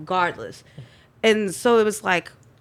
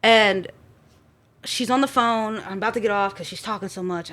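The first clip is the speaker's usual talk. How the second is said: normal talking voice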